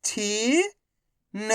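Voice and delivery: each syllable slowly held, first one rising. Shown as a demonstration. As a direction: break the word into long, separate syllables. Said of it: "एक अक्षर का उच्चारण करके".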